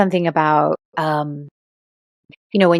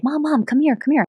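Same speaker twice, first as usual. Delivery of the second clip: put-on high, eager kid calling mom; a little boy eagerly calling his mom; excited child; 10 semitones higher than usual. Son calling his mom over to the class table.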